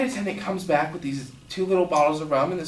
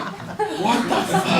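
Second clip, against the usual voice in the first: very high, drawn-out squeak; mock-horrified high-pitched question; stunned disbelief; 13 semitones higher, much slower than usual. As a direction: squeak it slowly, aghast. Stunned reaction to drunk's announcement.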